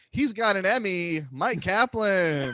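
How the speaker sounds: announcer introduction. Acted. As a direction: hail the guest like a show announcer. Host announces the second guest.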